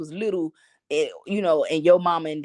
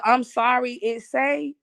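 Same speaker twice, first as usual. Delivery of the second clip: higher, sassy fake-sorry tone; mock snooty apology; 6 semitones higher, slower than usual. Imitating the cashier's snooty sorry, pitched up. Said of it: "when she hit me with the".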